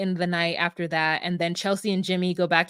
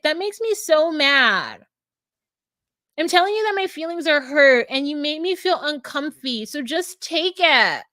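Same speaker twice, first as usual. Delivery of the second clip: Chelsea complaining; 6 semitones higher than usual. Retold or imitated. Chelsea telling Jimmy her feelings are hurt, exaggerated whining. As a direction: imitate a hurt, whiny demand. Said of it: "starts to complain about".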